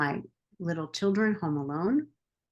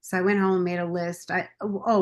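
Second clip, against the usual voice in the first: normal talking voice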